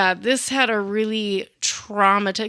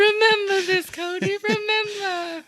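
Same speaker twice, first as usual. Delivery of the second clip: hammed-up tearful parent voice; mock wife; 11 semitones higher, 4 dB louder than usual. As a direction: drag out remember like a teary mom.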